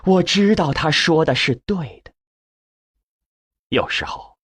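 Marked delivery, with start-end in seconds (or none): none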